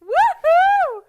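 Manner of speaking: high, loud cheer; excited whoop. Real, not acted. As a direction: whoop at a risky flip. Flipping food in pan.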